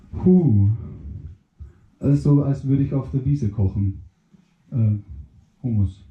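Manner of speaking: very low, dopey mushroom voice. Acted. Mushroom reacts.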